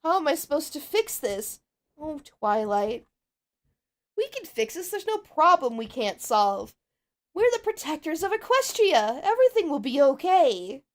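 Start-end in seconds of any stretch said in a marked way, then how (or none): 2.0-10.8 higher pony voice, gently comforting; Pinkie Pie reassuring. Acted. Pinkie comforts a frightened Twilight in a higher, hopeful voice. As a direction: lift her up with a bright, hopeful voice. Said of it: "possibly I'll try and do something for Pinkie Pie".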